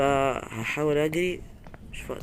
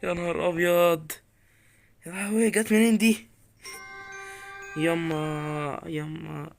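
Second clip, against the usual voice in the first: rattled, raised-pitch alarm; badly startled; 5 semitones higher, slower than usual. Real, not acted. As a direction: exclaim as if the scare just hit. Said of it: "أنا أول مرة أتخض كده".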